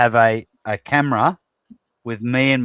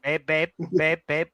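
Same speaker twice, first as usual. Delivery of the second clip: higher, lightly done mock Thai accent; nagging babe in a light put-on Thai accent; pleading Thai accent impression; 3 semitones higher, 4 dB quieter than usual. Wife asking him about the kick, light mock Thai accent. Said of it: "And she's like".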